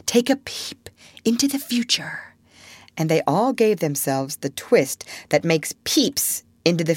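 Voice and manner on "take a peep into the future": high, hushed, mysterious storytelling; grand effort. Acted. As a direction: draw the children into a secret. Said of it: "bracing herself up for her finest effort".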